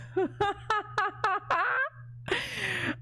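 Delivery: high crying laugh. Real, not acted. Laughing at her own accent bit. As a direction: break into a teary laugh.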